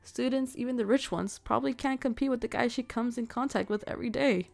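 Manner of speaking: loud know-it-all rival spreading rumors; gossiping rival, loud and pushy. Acted. Rival lecturing a lovesick boy, loudly. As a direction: lean in hard and dish the gossip loudly.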